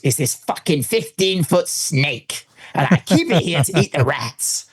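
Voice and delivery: slow, put-on American explanation; American guide. Guide explains his snake to visitors, slow imitated American. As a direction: imitate a proud American keeper, drawn out. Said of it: "He goes".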